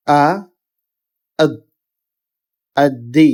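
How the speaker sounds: isolated syllables, then the doubled consonant held as in আব্বি; tashdid drill. Shown as a demonstration. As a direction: build আ, আব, আব্বি step by step with pauses. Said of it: "তাহলে আমাদের উচ্চারণটা হবে". (আ, আব, আব্বি: ভেঙে ভেঙে তাসদীদের উচ্চারণ)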